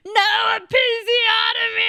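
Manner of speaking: high, shouted war cry; screaming into the ether; battle cry. Retold or imitated. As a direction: yell it like charging into battle. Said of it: "like a whole battle cry".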